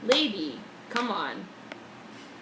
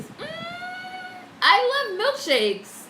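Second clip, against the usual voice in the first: dreamy gush; 4 dB louder, much slower than usual. Real, not acted. Swooning over milkshakes.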